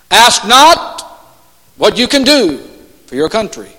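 attempted New England accent, measured and oratorical; loosely imitated New England accent, pausing between phrases. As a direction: try a JFK-style New England accent. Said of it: "I'm trying to do my New England accent".

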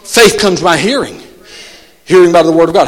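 ordinary delivery

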